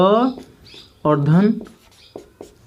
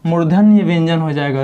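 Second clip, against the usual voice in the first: normal talking voice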